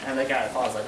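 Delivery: ordinary talk, nothing marked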